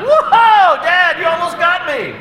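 put-on high, loud taunting kid voice; gleeful boy. Son bobbing away from dad's grab, high and loud. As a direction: whoop it up like a dodging boy.

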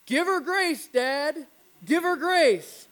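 put-on high, loud child's yell from the other room; yelling kid brother. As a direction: call out like an eager little boy. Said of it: "starts yelling".

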